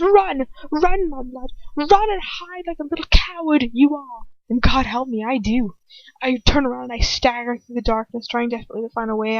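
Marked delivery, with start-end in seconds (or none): 0.0-4.3 high, loud witch screaming at her prey; screaming taunt. A narrator's reading. Bellatrix screaming at the fleeing captive, gleeful and cruel. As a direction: scream it like chasing a frightened animal. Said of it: "Bellatrix screams".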